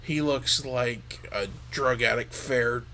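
usual speaking voice

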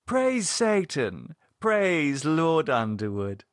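slow, high ecstatic chant; slow fervent praise. A narrator's reading. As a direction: proclaim it slowly like a ritual. Lydia praising in ritual.

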